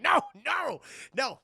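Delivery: high, loud mock protest; hammed-up horrified refusal; appalled refusal. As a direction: refuse in exaggerated shock. Acted refusal to the robber.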